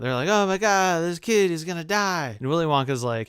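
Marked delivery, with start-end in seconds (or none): 0.3-2.4 freaking out. Acted. Crowd watching the kid sucked up a pipe, freaking out. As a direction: cry out in hammed-up horror. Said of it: "everyone starts freaking out".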